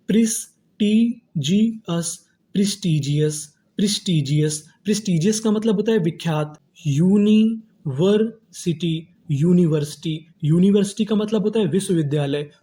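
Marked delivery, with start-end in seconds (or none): 0.1-2.2 prestigious split slowly as प्रिस, टी, जी, अस; separate, drawn-out parts of prestigious; syllable-by-syllable. Shown as a demonstration. Teacher building prestigious for students, slow and split. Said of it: "तो meaning क्या बनी". 6.9-9.1 university split slowly as uni, वर, city; syllable-by-syllable. Shown as a demonstration. Teacher building university for students, slow and split. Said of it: "तो meaning क्या बनी".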